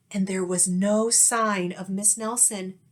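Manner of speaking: usual speaking voice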